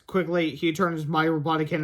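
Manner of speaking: ordinary delivery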